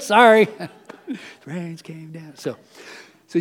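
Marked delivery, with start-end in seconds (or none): 1.1-2.3 much softer, slow, ominous hush; a spooky storybook hush; storyteller whispering doom; dramatic storytelling. Acted. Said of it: "I told you I was gonna do it".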